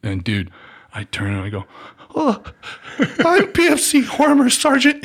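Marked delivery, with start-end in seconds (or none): 2.1-5.0 squeaky scared private. Retold or imitated. Nervous private reporting to sergeant.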